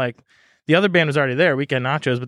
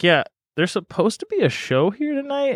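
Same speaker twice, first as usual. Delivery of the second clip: retold puzzlement; 7 semitones higher than usual. Puzzled band arriving at a house.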